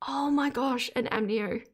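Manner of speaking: ordinary talk, nothing marked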